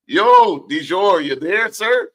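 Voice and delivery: loud high call across the line. Real, not acted. Host calling out loudly to his guest on the line. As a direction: holler for Dijon to answer.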